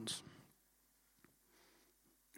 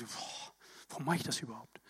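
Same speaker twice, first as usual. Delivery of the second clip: discouraged mutter; 7 semitones lower, 11 dB quieter, faster than usual. Retold or imitated. Inner thought, discouraged sigh.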